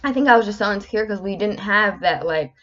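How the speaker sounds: ordinary talk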